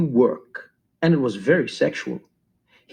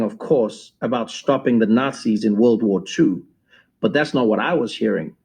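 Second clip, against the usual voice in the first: usual speaking voice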